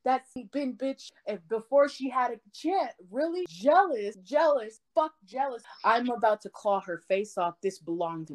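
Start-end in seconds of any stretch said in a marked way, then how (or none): 0.0-5.6 heated rant. Real, not acted. Heated rant about rival.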